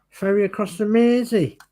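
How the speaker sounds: high-pitched, hammed-up Scouse; awful Scouser accent. Host teases about Merseyside in an awful Scouse accent. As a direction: put on a bad Liverpool accent, pitched high. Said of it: "that's my awful, um, Scouser accent".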